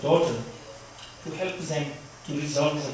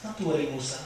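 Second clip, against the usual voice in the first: hushed self-reassurance, re-enacted; talking to oneself; 7 dB quieter, faster than usual. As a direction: soothe yourself softly, under your breath. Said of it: "I said to myself".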